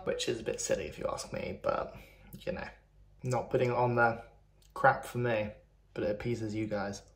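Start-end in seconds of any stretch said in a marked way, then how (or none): none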